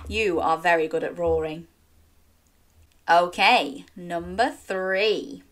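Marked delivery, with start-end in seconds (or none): none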